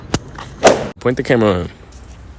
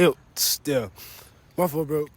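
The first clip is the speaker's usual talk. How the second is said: ordinary talk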